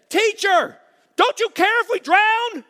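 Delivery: high, loud, panicked plea; panicked cry. Acted. Disciples screaming to Jesus in the storm, panicked high cry. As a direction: shriek it like terrified disciples in a sinking boat.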